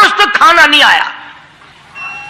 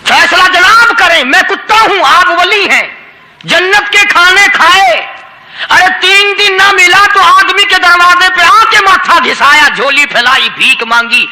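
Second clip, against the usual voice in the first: talking dog; 5 semitones higher than usual. Acted. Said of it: "وہ یہ کہنے لگا".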